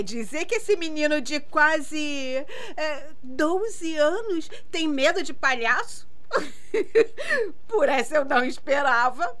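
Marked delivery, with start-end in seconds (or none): 6.1-7.6 high teasing giggle; mocking laugh. Real, not acted. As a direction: laugh at his fear. Aunt laughing at nephew's fear.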